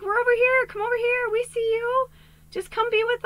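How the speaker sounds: spirits calling. Retold or imitated. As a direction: call like spirits beckoning across the fog. Spirits voiced calling to her.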